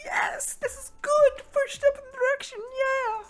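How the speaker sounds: hammed-up squeal of joy, very high and loud; much happier. Girl thrilled Gale's talking to her now, squealing happily. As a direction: gush in a piercing, overjoyed girl voice. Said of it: "So much happier now".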